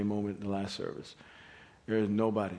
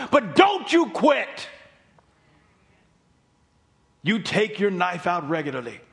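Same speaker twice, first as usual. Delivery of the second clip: preaching at full force; 11 semitones higher, 10 dB louder than usual. Acted. Preaches to the congregation.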